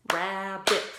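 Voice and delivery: "Rabbit" loud, split into rab-bit; "Rabbit" chanted in claps; clapped syllables. Shown as a demonstration. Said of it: "Go ahead and clap it".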